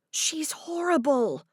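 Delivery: ordinary delivery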